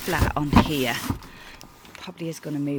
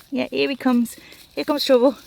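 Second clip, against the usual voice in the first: excited; 8 semitones higher, 9 dB louder than usual. Real, not acted. Dog arrives.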